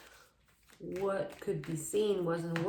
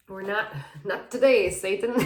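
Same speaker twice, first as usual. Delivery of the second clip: laughing defiance; 9 dB louder than usual. Pretend.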